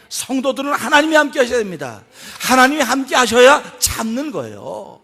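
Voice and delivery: loud, forceful pulpit declaration; preaching at full force. Real, not acted. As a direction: hammer each clause home to the congregation.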